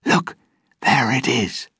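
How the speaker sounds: high, hushed-edged exclamation; excited cry. A narrator's reading. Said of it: "Pintel cried".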